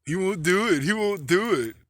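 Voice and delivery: high, loud sing-song jeer; mocking taunt. Idiots goading him, jeering high and loud. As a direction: taunt him like a schoolyard bully. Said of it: "making fun of me".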